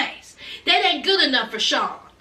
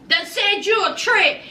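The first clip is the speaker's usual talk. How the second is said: normal talking voice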